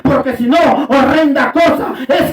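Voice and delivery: loud, driving revival preacher; preaching at full force. Real, not acted. (prédica enérgica a toda voz)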